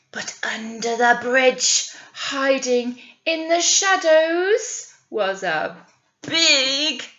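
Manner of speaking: slow, dramatic storyteller voice; drawing children into the scary part; spooky story narration. Acted. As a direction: build the scary troll up slowly. Spooky troll description.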